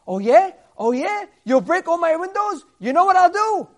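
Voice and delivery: loud, high, mocking comeback; indignant "oh yeah?" shouted; defiant retort. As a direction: throw the threat right back at him. Rabbi defiantly answers the threat.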